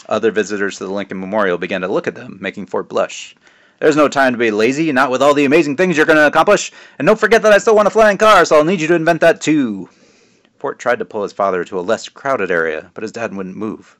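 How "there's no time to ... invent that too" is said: higher, louder jovial dad voice; teasing dad. Acted. Father teasing his son at the memorial, jovial and loud. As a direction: play the proud joking father.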